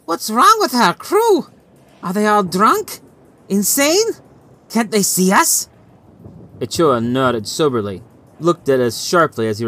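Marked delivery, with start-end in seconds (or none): 0.0-5.7 high, alarmed sailor's voice, much higher; Legal crying out. A narrator's reading. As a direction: cry out in disbelief at the ship. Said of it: "Legal cried out".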